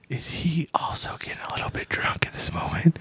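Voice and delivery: hushed aside, breathy and low; whispering. Real, not acted. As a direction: whisper it behind his back. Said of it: "Why are we whispering?".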